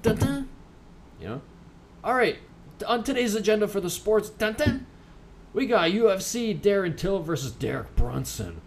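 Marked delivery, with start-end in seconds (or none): 0.0-0.5 high-pitched sung-out "dun, dun" sting; sound effect. Shown as a demonstration. Host mimicking a sports segment sound effect, high mock sting. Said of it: "a sound effect that, that goes like". 4.3-4.9 high two-note "dun, dun" sting; sound effect. Shown as a demonstration. Host punctuating today's sports agenda, high mock sting. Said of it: "a sound effect that, that goes like".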